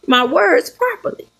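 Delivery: ordinary talk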